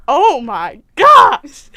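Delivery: loud, very drawn-out exasperation; slow, hammed-up exasperation; exasperated outburst. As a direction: drag out every word, fed up. Acted exasperation at being stopped again.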